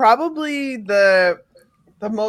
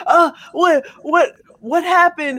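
mock concern; 7 semitones higher than usual. Gullible friend hearing bad news, fussing in high mock worry. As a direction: rush in all worried. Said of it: "I'll just be like".

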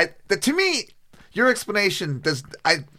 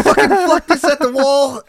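high, loud mock panic; panicked shout; 9 semitones higher, 6 dB louder than usual. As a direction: yelp it and bolt.

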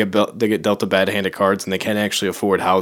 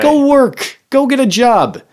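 higher, louder retold telling-off; mock yelling; 14 semitones higher, 5 dB louder, slower than usual. Storyteller acting out yelling at Sherry, high and loud. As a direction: yell it high and scornful. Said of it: "I'm like".

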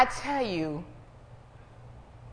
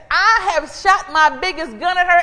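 loud, high, gloating devil; the devil yelling in defeat; the devil boasting; 9 semitones higher, 9 dB louder than usual. Acted. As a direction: shout it as the frustrated devil bragging. Devil voiced taunting in sermon.

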